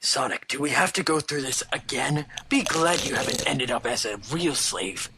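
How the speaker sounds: low and soft, shushing; hushed, nearly whispered. Acted. Shadow hushes Sonic while they sneak, low and hushed. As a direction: keep it down, sneaking. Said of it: "Shadow's line, shush".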